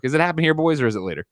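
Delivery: normal talking voice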